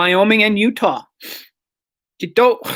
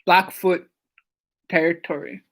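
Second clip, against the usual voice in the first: ordinary delivery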